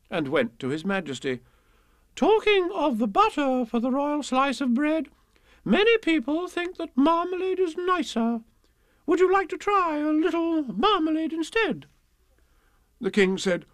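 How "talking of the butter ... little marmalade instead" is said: very high, grand, fluting voice; hammed-up queen, loud and high; queenly. A narrator's reading. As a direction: coax the King in a high royal voice. Queen broaches marmalade to the King.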